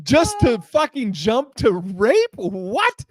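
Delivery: loud, high, slow disbelief; incredulous outburst. Retold or imitated. Man stunned at being warned of accusation, incredulous. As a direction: stretch out the outraged question for laughs.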